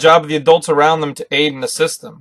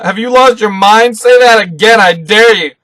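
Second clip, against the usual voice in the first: angry; 7 semitones higher, 8 dB louder than usual. Retold or imitated. Usopp furious at Luffy's refusal.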